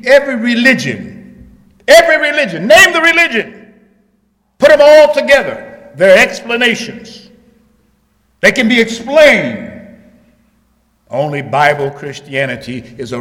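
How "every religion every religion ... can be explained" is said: loud preacher's cry, punching each phrase; full-force preaching. Real, not acted. Preacher proclaiming Christ unchanged to the congregation, full-force preaching. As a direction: preach it loud, higher, with falling phrase ends.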